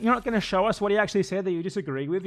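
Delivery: ordinary delivery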